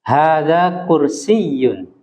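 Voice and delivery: "kursiyun" with a doubled y, slow and clear; slow teacher model of "kursiyun"; model pronunciation. Shown as a demonstration. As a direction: stress the shadda in "kursiyun". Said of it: "Ada tasdid di ya-nya".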